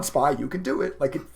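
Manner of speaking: ordinary talk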